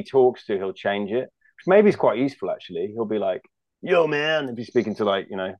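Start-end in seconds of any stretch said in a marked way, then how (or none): 3.8-4.5 a quick American "Yo, man"; American accent. Retold or imitated. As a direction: slip into a casual American accent. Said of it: "that was my American accent".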